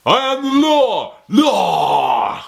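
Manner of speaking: hammed-up bellowed catchphrase, high and drawn out; Judge Dredd. Reviewer mocking a film's famous line, hammed-up yell. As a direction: howl it like an over-the-top action lawman. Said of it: "Judge Dredd".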